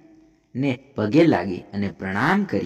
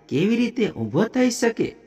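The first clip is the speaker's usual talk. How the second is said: much higher-pitched, astonished question; amazed question; 11 semitones higher than usual. A narrator's reading. Onlookers marvel at the dead youth standing, amazed. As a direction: ask it high, in mild storyteller's amazement.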